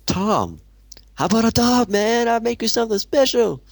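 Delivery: high put-on vendor voice; Tom's voice. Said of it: "I'll try and do the voices".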